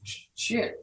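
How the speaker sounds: stunned whispered curse; hushed disbelief. Retold or imitated. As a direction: breathe it out under your breath, barely voiced. Stunned reaction on getting job.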